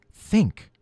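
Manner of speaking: high whispered "Think." to himself. A narrator's reading. Peter whispers to himself while deciding, breathy whisper.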